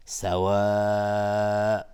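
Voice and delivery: سوا stopped on a long, loud, level held vowel; madd held at the stop. Shown as a demonstration. Teacher showing a pause on the madd, سوا chanted long. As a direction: draw out the last vowel of سوا and stop there. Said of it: "مد عارض للسکون اگر توقف کردیم".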